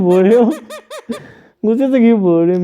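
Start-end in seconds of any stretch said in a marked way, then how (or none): none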